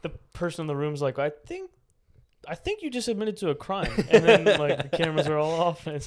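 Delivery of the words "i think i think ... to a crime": high voice. Acted. Person in room reacting.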